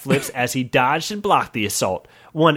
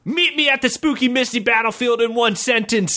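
high challenge; 9 semitones higher than usual. Acted. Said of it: "said the warrior".

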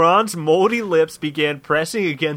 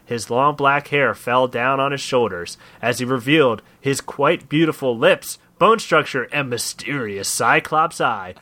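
plain reading voice

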